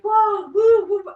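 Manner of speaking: high, loud mock mystic woo. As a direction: send up a dreamy spiritual type. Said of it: "I'm not spiritual and I'm not like".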